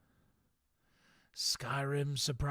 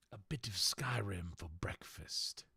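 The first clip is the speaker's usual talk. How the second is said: whisper; 3 semitones lower, 7 dB quieter than usual. Pretend. Whispered aside.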